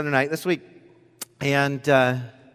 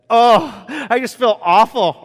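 anguished; 9 semitones higher, 9 dB louder, slower than usual. Retold or imitated. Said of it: "dad feeling awful".

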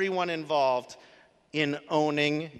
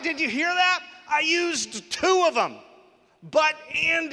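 much higher and louder, caught himself out; gleeful gotcha, pitched way up; amazed exclamation; 10 semitones higher, 5 dB louder than usual. Real, not acted. Catches his own deflection.

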